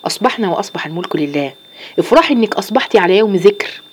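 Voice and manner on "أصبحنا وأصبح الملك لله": hushed, gentle recitation of the morning remembrance; soft morning dhikr. Shown as a demonstration. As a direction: murmur it softly like a prayer on waking. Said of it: "أول ما تصحي تقولي إيه". (ذكر الصباح بصوت خافت هادئ)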